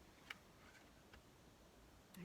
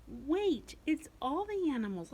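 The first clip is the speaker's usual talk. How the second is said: mock surprise; 6 semitones higher, 5 dB louder than usual. Mock discovery for kids.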